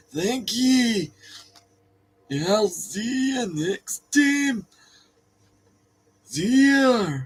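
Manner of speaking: high, slow, drawn-out comic voice; silly sign-off voice. Pretend. Host saying goodbye to viewers, silly high voice. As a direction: stretch the vowels in a silly high voice.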